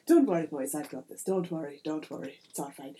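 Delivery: put-on low, hushed reassurance to his boys; confident leprechaun leader. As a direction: settle the gang down, voice dropping. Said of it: "and he says".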